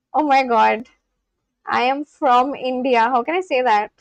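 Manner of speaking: usual speaking voice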